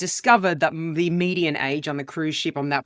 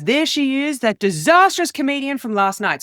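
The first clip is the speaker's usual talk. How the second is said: mock heckler taunt; 7 semitones higher, 5 dB louder than usual. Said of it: "and he goes".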